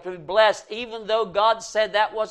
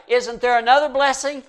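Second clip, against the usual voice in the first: high, louder pleading cry of a son; crying plea; 8 semitones higher, 7 dB louder than usual. Retold or imitated. Son begging his father, raised pleading mock cry. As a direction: beg the father through mock tears. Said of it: "he cried".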